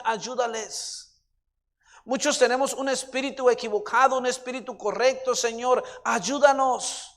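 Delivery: loud, pleading prayer; fervent prayer. Real, not acted. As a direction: cry out to God, drawing out the pleas. Prayer rising to a fervent plea.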